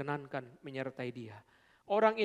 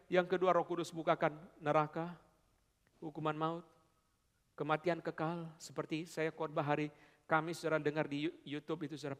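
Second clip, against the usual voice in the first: ordinary talk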